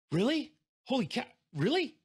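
high, loud, delighted disbelief; thrilled disbelief. Retold or imitated. A salesman gets good news on the phone, thrilled and loud. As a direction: burst out with overjoyed surprise. Thrilled reaction to the plane offer.

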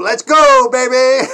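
loud rallying shout; a loud hype cheer to sign off; team cheer. Real, not acted. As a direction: fire everyone up, loud.

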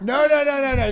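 loud, high, laughing no; laughing protest. Acted. Host laughing off a caller's cheap spunk jab. Denies cheap imported product.

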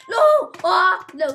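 high, loud scream of no; frightened shriek; panicked scream. Real, not acted. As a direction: yelp in real panic as it attacks. Attacked by the cat mob.

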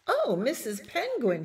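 high-pitched little bird's call; crying out. A narrator's reading. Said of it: "he cried".

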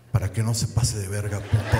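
low, menacing tough-guy threat. Retold or imitated. The big guy threatens the man he slapped, low and menacing. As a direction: drop the voice and threaten him. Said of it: "Puse mi cara de culero y le dije".

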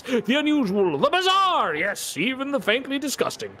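high, loud carnival barker cry; showman barker. Acted. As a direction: sell the freak show to the crowd.

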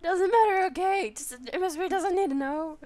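high, loud mock protest; defensive outburst. Player brushes off her own cheapness to viewers, loud and defensive.

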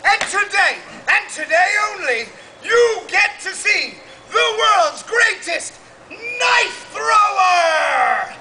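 booming showman announcement; carnival barker. Acted. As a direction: sell it to the crowd. Fair barker announcing acts.

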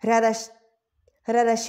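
ordinary delivery

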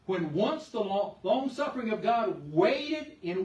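slow, loud scripture reading building to waited; emphatic reading. Shown as a demonstration. Preacher reading the verse again to the church, slow and loud. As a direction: draw out long, long suffering. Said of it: "Listen to what it says".

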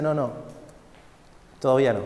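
a soft, smiling no; calm master. Acted. The master quietly refuses the cup, low and gentle. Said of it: "me sonreía y me decía".

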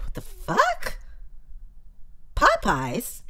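squeaky outraged disbelief; mock disbelief. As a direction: shoot up in pitch, baffled. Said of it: "I'm like".

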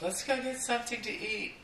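high, sweet girlfriend impression; high mock girlfriend asking. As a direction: play the girlfriend in a high put-on voice. Girlfriend voiced in story.

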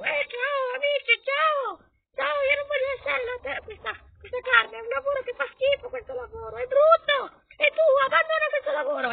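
very high-pitched squeaky little worker; strange character voice. Pretend. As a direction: whine and complain in a tiny high voice. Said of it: "non so perché ha quella voce strana".

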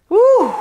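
sharp high whew of disbelief; long exhale whew. Real, not acted. Speaker recalling a tense memory, high startled whew. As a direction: blow out a big high whew.

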